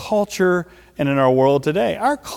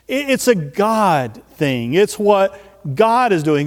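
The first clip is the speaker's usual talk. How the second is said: ordinary talk, nothing marked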